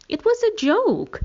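very high, loud, drawn-out protest; defensive. A narrator's reading. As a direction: stretch the words in squeaky-high self-defence. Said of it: "Stevie defended herself".

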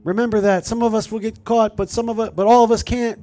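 high, loud rallying cry to the crowd; rally the protesters like a freedom rider; rousing rider on horseback. Retold or imitated. Imitating the horseback rider rallying people at the barricades. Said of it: "being like".

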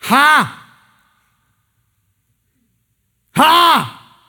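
loud, high demonic cry; demon shout. Acted. Preacher acting the demon crying out, loud and high. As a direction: bark it out like a possessed man. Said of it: "That's the demon".

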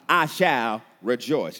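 very slow, loud declaration; weighty, drawn-out vow; resolute answer. Acted. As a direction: declare it firmly, stretching every word.

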